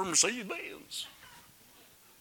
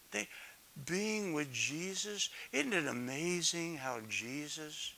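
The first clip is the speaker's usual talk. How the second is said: usual speaking voice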